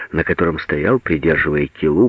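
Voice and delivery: plain reading voice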